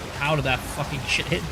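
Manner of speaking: usual speaking voice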